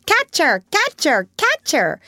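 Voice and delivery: high squawky parrot cry; parrot calling out. A narrator's reading. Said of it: "calling out".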